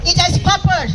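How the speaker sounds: usual speaking voice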